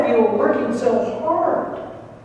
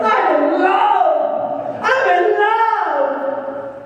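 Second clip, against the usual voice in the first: high, slow, elated cry of love; drawn-out joyful shout of being in love; gushing in love; 8 semitones higher, much slower than usual. Retold or imitated. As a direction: sing it out like you just fell in love. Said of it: "you fall in love with somebody and you're like".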